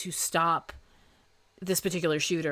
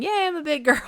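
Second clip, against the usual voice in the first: put-on high, louder little-kid cheer on yay; childlike cheer; 9 semitones higher, 4 dB louder than usual. Forty-seven-year-old mimicking a kid, high and louder. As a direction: chirp it like a proud small child. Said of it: "I'm forty-seven saying".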